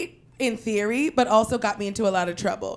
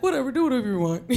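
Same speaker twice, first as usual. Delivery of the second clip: dad's shrug; 2 semitones higher, faster than usual. Retold or imitated. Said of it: "That's his kind of attitude".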